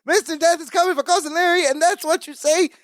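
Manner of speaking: high-pitched exasperated rant, mock screaming; Balki. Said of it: "he's screaming himself into a headache".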